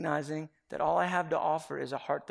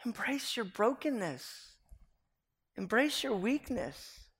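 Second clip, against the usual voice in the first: higher, slow, each word laid down; slow earnest appeal; 6 semitones higher, slower than usual. Real, not acted. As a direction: plead with them to embrace it. Preacher's slow emphatic appeal.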